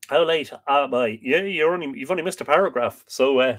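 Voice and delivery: ordinary talk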